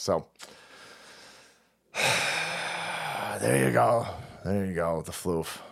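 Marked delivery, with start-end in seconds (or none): 1.9-3.4 long, breathy, heavy exhale; heavy sigh over a loss. Real, not acted. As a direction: let out a drawn-out sigh. Grieving the dead cat.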